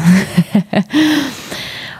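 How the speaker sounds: real laughter, trailing off. Real, not acted. Reader to listeners before the retake, laughing.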